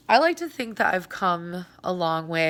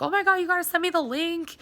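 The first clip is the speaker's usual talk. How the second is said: fast, much higher, excited gushing; excited friends; 11 semitones higher, much faster than usual. Retold or imitated. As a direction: gush like an eager friend. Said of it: "they were like".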